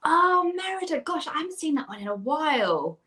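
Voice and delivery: high, gushing exclamation; light up at a fond memory, pitch soaring; delighted surprise. Real, not acted. Guest reminded of Merida by interviewer, delighted surprise.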